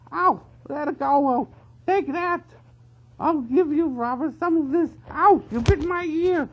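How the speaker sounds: high, loud pained squealing; brawling yells. A narrator's reading. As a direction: yelp mid-fight. Weasels brawling.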